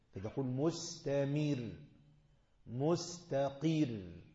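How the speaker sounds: "مستقر" slowly drawn out with a thin light ra; light ra demo. Shown as a demonstration. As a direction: stretch "مستقر" and keep the ra light. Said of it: "شايفين الترقيق ده؟".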